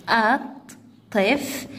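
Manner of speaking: الطفل slowly as at, tif, lu; syllable by syllable. Shown as a demonstration. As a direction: split الطفل into three separate beats. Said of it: "تقطيعها".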